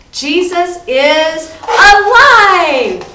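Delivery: big, high, slow joyful shout; excited cheer. Shown as a demonstration. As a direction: cheer it out long and loud for the kids. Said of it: "but you can go".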